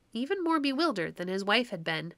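ordinary delivery, nothing marked

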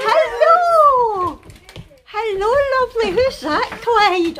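high, gushing hellos; excited high pet-greeting voice; cooing puppy talk. Real, not acted. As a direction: coo at a puppy, pitched right up.

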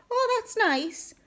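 very high, slow, mocking coo; mimicked oblivious cheer. Jeremy imitating her replies to his uncle, teasingly cheery. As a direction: mimic her clueless sing-song approval. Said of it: "And you're going".

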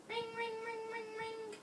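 soft, high sing-song ring; phone ringing. Acted. Child imitates the phone ringing, soft high ring.

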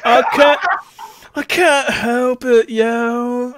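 high, drawn-out loud protest; helpless dude. Acted. Felinor excusing the noise to Gwen, high dude wail. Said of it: "She's like".

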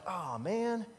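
ordinary talk, nothing marked

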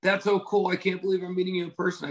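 high, gushing mock excitement; imitating a thrilled fan. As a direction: play the starstruck fan meeting him. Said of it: "She goes".

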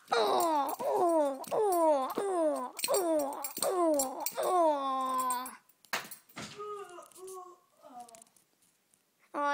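loud hammy dying moans; shark dying groans. Pretend. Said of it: "that was just a shark dying".

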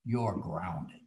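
low, slow mother's voice, lightly done; a dry, unimpressed mom settling it; deadpan mother. Retold or imitated. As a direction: drop low and slow as a matter-of-fact mother. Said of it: "And she said".

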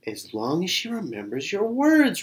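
high, swooping musing; thoughtful repeating. A narrator's reading. Bird repeats Xiao's words, thoughtfully. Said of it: "repeated the bird thoughtfully".

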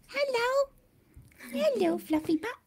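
high, sing-song voice for the cat; high cooing hello to the cat Merlin; pet talk. Real, not acted. Talking to the cat.

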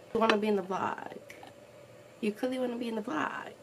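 normal talking voice

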